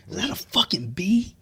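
put-on very high, innocent child's question; daughter voice. Dad imitates his six-year-old asking about a bee. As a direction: pitch way up like a small kid spotting a bee. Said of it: "She goes".

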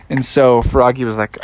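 ordinary delivery

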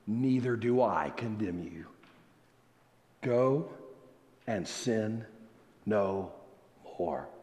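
low, soft words with long pauses; hushed solemn pardon. Acted. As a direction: pronounce the pardon quietly and gravely, phrase by phrase. Solemnly voices Jesus' pardon.

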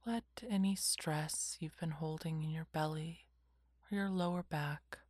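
whispered guided meditation; slow calming whisper, lots of pauses; soft spa whisper. Acted.

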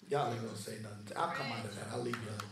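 low, soft aside to the congregation; quiet aside. Real, not acted. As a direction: drop right down and back off the point.

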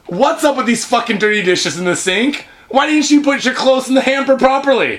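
high-pitched scolding mother; nagging mom. Acted. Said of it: "your mom's voice and she's just bitching".

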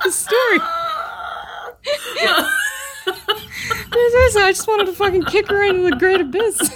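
helpless giggles through a mock rant; laughing mock remembrance. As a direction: ham it up while breaking into laughter. Joking mock recollection, laughing hard.

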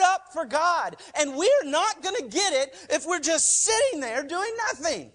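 high, loud fired-up yelling; preaching yell. Real, not acted. Pastor yelling at his congregation about being fired up. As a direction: yell it at the congregation to fire them up. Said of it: "I'm done yelling at you guys today".

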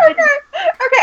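high laughing okay okay; real giggling protest. Real, not acted. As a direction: laugh while trying to calm things down. Laughing, trying to clarify.

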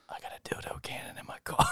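hushed, voiceless secret; conspiratorial whisper. Acted. Interviewer confiding in a job applicant, whispering.

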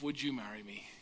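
very soft, low question; hushed, low-voiced plea; tender proposal. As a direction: propose quietly and humbly. Retells his proposal on one knee.

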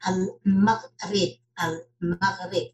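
Al-Maghrib said slowly and firmly, in Arabic; Al-Maghrib, slow and deliberate with Arabic sounds; native pronunciation. Shown as a demonstration. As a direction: show how Al-Maghrib is pronounced, drawing it out. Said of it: "This is how we pronounce it".

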